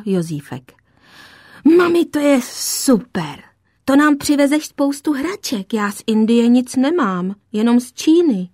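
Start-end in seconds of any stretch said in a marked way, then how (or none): 1.8-8.5 a hint of a delighted young boy, higher; an eager kid blurting out his joy; lightly voiced little boy. Son Jozífek cheering mother's trip to India, excited boy.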